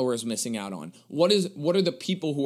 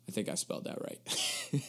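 whispered aside; 5 semitones lower, 10 dB quieter than usual. Real, not acted. Aside after spelling the word.

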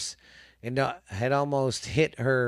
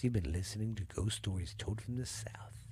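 whisper; 5 semitones lower, 14 dB quieter than usual. Acted. Spooky podcast sign-off.

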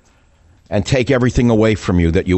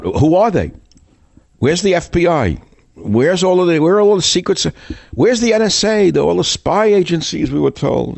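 rising outraged rant; 9 semitones higher than usual. Real, not acted. Radio host demanding where the FBI is, rising outrage. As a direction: pitch climbing higher with each question, demanding answers. Ranting at missing agencies.

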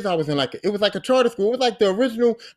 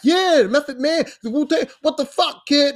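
higher, mock-irritated yell; imitating an annoyed vendor's yell; 8 semitones higher than usual. Vendor yelling at the kid over the tape, hammed-up irritation. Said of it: "he start yelling at me".

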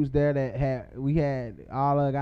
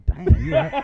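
laughing; 9 semitones higher, 4 dB louder than usual. Retold or imitated. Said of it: "I said".

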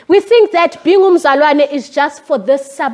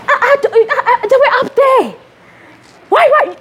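high, giggling through the words; laughing; 9 semitones higher than usual. Real, not acted. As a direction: laugh at herself while admitting it. Laughs recalling her anger.

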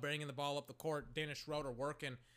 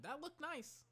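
mock admiring; 10 semitones higher, 8 dB quieter, slower than usual. Real, not acted. Repeated admiring remark.

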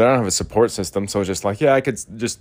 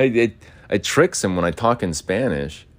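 ordinary talk, nothing marked

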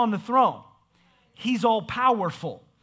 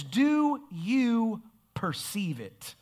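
slow voicing God; 4 dB quieter, much slower than usual. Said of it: "And he says".

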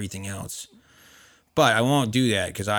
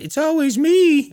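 whiny complaint; 12 dB louder, slower than usual. Retold or imitated. Mocking what he'd say.

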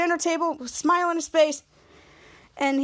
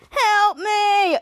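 high, hammed-up plea; mock cry for help; 6 semitones higher, 4 dB louder than usual. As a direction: play-act a victim calling for rescue. Choked character cries for help.

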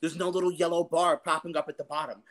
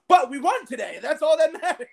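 higher, louder, laughing through it; giddy triumph; 9 semitones higher, 5 dB louder than usual. Retold or imitated. Fan telling the lieutenant why he's celebrating. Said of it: "I was like".

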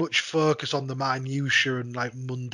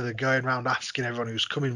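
normal talking voice

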